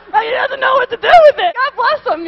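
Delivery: high, very loud, pleading for sympathy; exasperated comic outburst. Real, not acted. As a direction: cry out in comic pity for the clueless. Comic outburst pitying clueless men.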